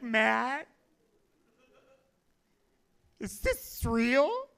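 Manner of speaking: slow, pleading question to a friend; frightened plea. Acted. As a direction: plead in mock bewilderment, drawn out.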